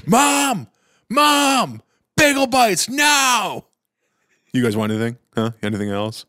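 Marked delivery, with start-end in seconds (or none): 0.0-3.7 drawn-out high-pitched yell for Mom; bratty shouted demand, high and stretched; Will Ferrell. Acted. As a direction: holler upstairs like a spoiled man-child. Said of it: "Almost like the, like, uh, Will Ferrell".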